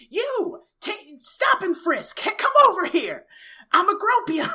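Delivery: over-the-top bossy cop; hammed-up cop bark. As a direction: play a power-drunk deputy yelling orders. Imagined Barney bossing people around.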